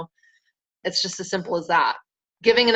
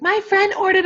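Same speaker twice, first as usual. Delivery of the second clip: much higher, louder imitation of sister; sister's complaint; 3 dB louder than usual. Sister reporting that a friend ordered, high-pitched mimicked complaint. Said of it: "She's like".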